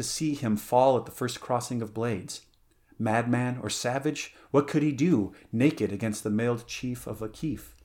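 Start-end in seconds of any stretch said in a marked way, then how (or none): none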